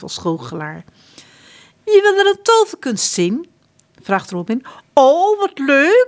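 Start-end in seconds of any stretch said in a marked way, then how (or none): 1.8-3.5 high, loud showman's call; magician. A narrator's reading. Robin disguised, inviting the prince, high and loud. 4.9-6.1 high, loud, slow gushing glee; drawn-out childish delight. A narrator's reading. Prince John thrilled at the magic trick, high and loud.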